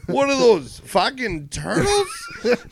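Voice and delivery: loud, high, drawn-out disbelief; incredulous outburst. Retold or imitated. Fan Kane spotting the turtle army, loud mock disbelief. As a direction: blurt it in high, hammed-up outrage, stretching the last word. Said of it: "Fan Kane was like".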